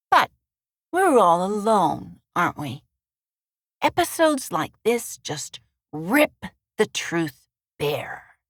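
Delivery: slow, a hint of gloom; lightly voiced bitter bartender. A narrator's reading. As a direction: let the words sink, understated.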